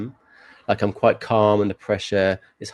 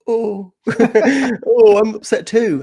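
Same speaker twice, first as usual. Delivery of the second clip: high, slow whiny little girl pretending to be upset; high whimpering child faking tears; mock fake crying; 11 dB louder, slower than usual. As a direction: imitate a child's put-on sob, pitched way up. Niece faking crying, mocked.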